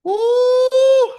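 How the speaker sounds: very high, loud hyped-up "Ooh"; excited squeal. Real, not acted. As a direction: let out a thrilled high squeal. Hyped after second wind.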